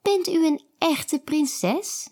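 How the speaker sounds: put-on high, curious child voice; little girl Kiki. A narrator's reading. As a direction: ask it like a wide-eyed little girl. Kiki's voice in the story.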